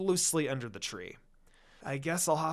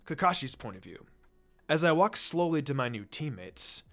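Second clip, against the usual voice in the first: plain reading voice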